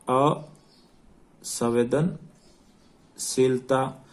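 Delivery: very slow dictation, pausing between the parts of असंवेदनशीलता; broken into parts. Shown as a demonstration. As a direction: draw out असंवेदनशीलता in separated chunks, अ, संवेदन, शीलता. Teacher repeats term slowly for dictation.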